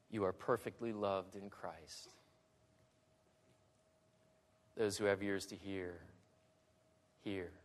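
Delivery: low, very soft preacher's appeal with long pauses; hushed sermon close. Acted. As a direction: let each phrase settle gently over the listeners. Hushed closing appeal to the congregation.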